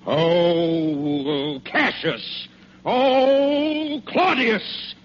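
rising theatrical wail of names; tricked villain wails his masters' names; lamenting. Acted. Villain realizes he was fooled.

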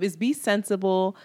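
ordinary delivery

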